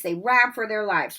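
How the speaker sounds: usual speaking voice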